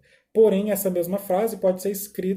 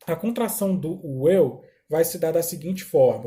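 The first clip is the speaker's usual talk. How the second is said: normal talking voice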